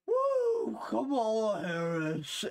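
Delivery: high woo fading into a slow, tired name; a breathless, pathetic fake cheer; winded mock cheer. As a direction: cheer that runs out of breath halfway. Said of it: "No, it's like".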